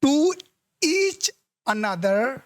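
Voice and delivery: slowly read-out wrong phrase; slow pointed reading. Shown as a demonstration. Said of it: "slow reading of the wrong part".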